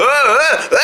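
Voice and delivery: ordinary talk, nothing marked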